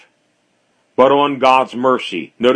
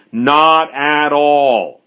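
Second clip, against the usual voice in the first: "Not at all" slow and firm, each word held; emphatic exclamation; much slower than usual. Acted. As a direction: draw out "Not at all" as a solemn denial. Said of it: "with an exclamation point".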